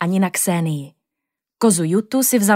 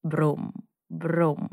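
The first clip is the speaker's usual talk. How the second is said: low, soft teddy bear rumble; quiet growly brum of a toy bear; bear growl; 3 semitones lower, 8 dB quieter than usual. Retold or imitated. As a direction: hum it like a sleepy bear. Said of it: "Uměl bručet".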